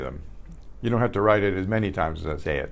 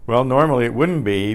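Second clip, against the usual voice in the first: normal talking voice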